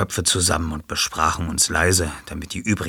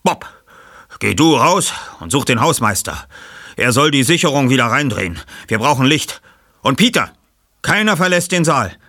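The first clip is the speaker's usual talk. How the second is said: energischer Anführer; 9 semitones higher, 5 dB louder than usual. Acted. Ich-Figur gibt Anweisungen.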